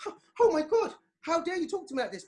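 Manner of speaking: put-on a flabbergasted mother scandalised by her teen; shocked parent. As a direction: parent stunned at a back-talking teenager, shrill with shock. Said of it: "You're like".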